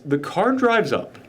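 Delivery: ordinary talk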